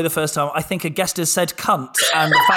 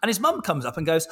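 usual speaking voice

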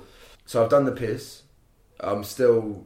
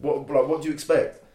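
higher, exasperated snapping back; indignant retort; 7 semitones higher than usual. Retold or imitated. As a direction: fire it back, irritated. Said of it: "and I'm like".

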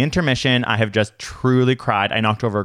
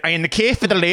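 higher, run-together British mimicry; imitated British accent; 6 semitones higher, much faster than usual. Stranger still asking about the loo queue, imitated British accent. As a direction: imitate a hard-to-follow Brit. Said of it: "He's like".

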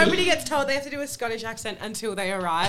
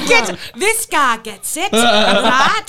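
oversell it to a dead crowd; desperate stand-up; 8 dB louder, much slower than usual. Acted. Comedian singling out an audience member, loud overselling. Bombing comic working the silent crowd.

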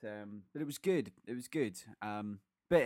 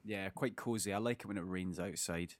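hoarse from yelling; 4 semitones lower, 6 dB quieter than usual. Real, not acted. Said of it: "my voice is a little bit shot today".

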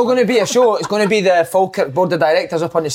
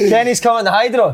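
normal talking voice

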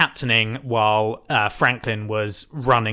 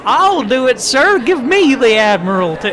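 fake Georgian accent; 10 semitones higher, 5 dB louder than usual. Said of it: "the fake Georgian accent".

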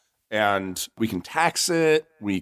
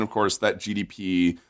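usual speaking voice

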